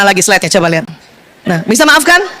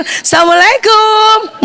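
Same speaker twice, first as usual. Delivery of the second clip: higher, drawn-out happy salam; giddy happy greeting; 5 semitones higher, slower than usual. Retold or imitated. Wife greeting the husband who picked her up, gleefully drawn out. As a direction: sing out the greeting, thrilled to be picked up. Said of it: "Saking senengnya berbunga-bunga".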